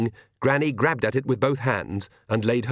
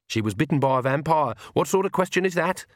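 plain reading voice

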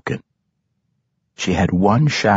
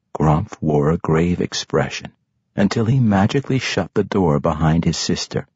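usual speaking voice

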